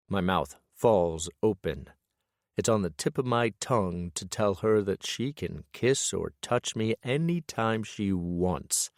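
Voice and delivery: ordinary delivery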